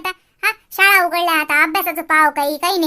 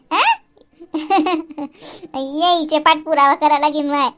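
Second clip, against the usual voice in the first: normal talking voice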